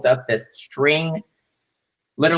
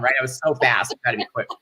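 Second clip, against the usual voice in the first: normal talking voice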